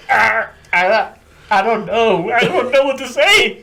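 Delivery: high, whiny mock panic; helpless recruit. Recruit being screamed at, high flustered whine. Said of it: "I'm just like".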